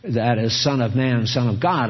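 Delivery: ordinary talk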